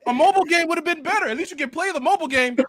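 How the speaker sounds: hammed-up disbelief from the pulpit; preacher scolding Lonnie's hopes; mock exasperated preacher.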